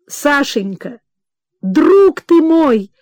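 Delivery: reach for him with the words; joyful exclamation. Acted. Mother greeting her son, crying out high and slow. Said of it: "воскликнула Анна Павловна".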